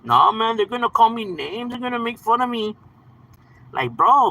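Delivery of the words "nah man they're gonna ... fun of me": higher, mock-whiny kid worry; mock worried complainer. Streamer mimicking someone afraid of being teased, mock worried. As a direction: imitate someone fretting like a kid, pitched up. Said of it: "you're worried about them".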